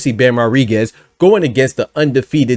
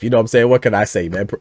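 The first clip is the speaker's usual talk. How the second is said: usual speaking voice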